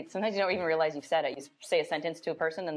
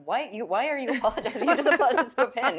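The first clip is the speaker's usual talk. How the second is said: fast, rising, louder disbelief with a laugh; incredulous; 4 semitones higher, 5 dB louder, faster than usual. Retold or imitated. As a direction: act baffled at someone apologising to a pin. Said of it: "I'm like".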